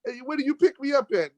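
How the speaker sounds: high mock call to an Uber driver; mocking incredulity. Mocking the lady's Uber idea, high sarcastic mock call. Arguing with tow truck lady.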